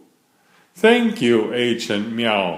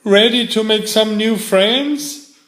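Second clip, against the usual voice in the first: cheerful invitation; 10 semitones higher, 5 dB louder than usual. Acted. Detective cheers squirrel on.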